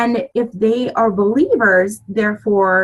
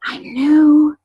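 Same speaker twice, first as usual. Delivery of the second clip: shy newcomer; 7 semitones higher than usual. Retold or imitated. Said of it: "and I said".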